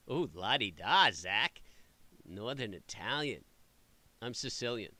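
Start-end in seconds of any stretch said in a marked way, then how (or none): 0.1-1.6 a drawn-out, loud teasing coo; mock-impressed teasing. Real, not acted. Host teasing a guest about being Venetian, a drawn-out, loud mock-impressed coo. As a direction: tease him for sounding fancy.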